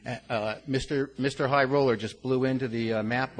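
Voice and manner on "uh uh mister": ordinary talk, nothing marked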